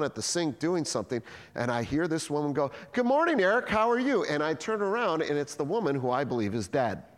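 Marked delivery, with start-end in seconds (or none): 2.9-4.2 high, bright hello from a coworker; cheery greeting. Retold or imitated. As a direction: sing out a cheerful good morning. Woman's cheery greeting in a story.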